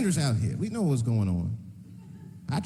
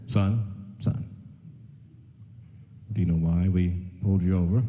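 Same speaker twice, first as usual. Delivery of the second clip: police officer; 11 semitones lower than usual. Acted. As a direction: pull a driver over in a very low voice. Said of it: "If the police pull me over".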